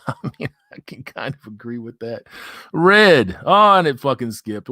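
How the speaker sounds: ordinary talk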